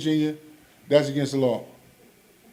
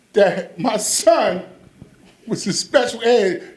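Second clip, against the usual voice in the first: dramatic realisation; 6 semitones higher, slower than usual. Real, not acted.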